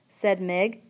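ordinary delivery, nothing marked

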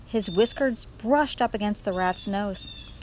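ordinary delivery

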